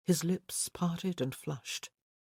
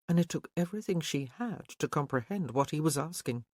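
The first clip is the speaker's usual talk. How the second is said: ordinary delivery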